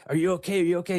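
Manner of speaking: high, urgent questioning of a waking patient; urgent nurse. Retold or imitated. Nurse running in to the patient who pushed the button, urgent. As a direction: imitate an alarmed nurse, pitched high.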